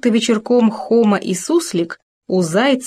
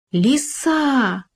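restrained storyteller's wail of alarm; crying out; 6 semitones higher than usual. A narrator's reading. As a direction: cry a warning with the last vowel held. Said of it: "возопил он".

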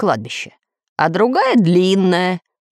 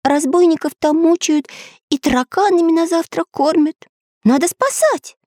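put-on high earnest boy voice; Pencil's voice; 11 semitones higher than usual. A narrator's reading. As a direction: plead it high.

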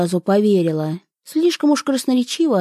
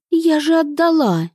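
irritated indignant; 7 semitones higher than usual. A narrator's reading. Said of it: "продолжила она негодующе раздражённо".

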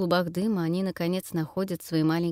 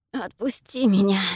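hoarse rasp, louder; 3 semitones higher, 6 dB louder than usual. A narrator's reading. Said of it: "хрипит Ниэла".

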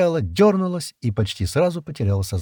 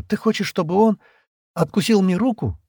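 high-pitched disbelieving question; surprised, slightly frightened; 10 semitones higher than usual. A narrator's reading. Шира asks Стэн about the hunter biting her arm, alarmed. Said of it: "удивлённо и немного испуганно спросила Шира".

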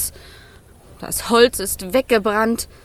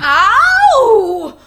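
loud pained yelp; 9 semitones higher, 9 dB louder than usual. Acted. Fire poked with iron.

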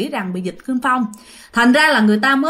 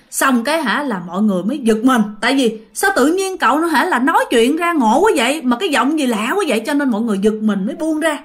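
normal talking voice

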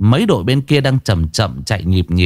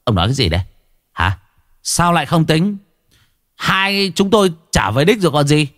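through gritted teeth; 6 semitones higher than usual. Acted. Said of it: "lão nghiến răng nghiến lợi".

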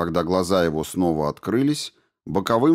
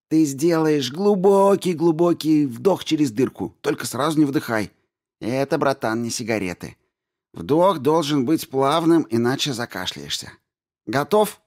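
eager coaching voice; 7 semitones higher than usual. A narrator's reading. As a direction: talk him through it gently. Shproty coaches the smoke.

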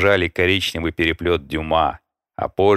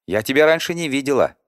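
put-on high, fast girl voice; girl voice, quick; 7 semitones higher, faster than usual. A narrator's reading. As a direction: say it quickly, a bit higher. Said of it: "призналась девушка".

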